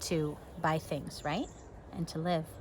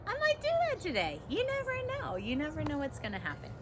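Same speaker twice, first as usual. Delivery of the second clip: teasing squeal up high; high, quick and playful, joking about tears; mock dramatic sing-song; 9 semitones higher, 5 dB quieter, faster than usual. Real, not acted. Teasing about crying over book.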